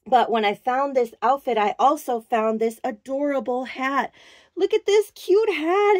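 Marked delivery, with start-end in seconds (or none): 4.6-6.0 gushing. Real, not acted. Showing off the doll hat.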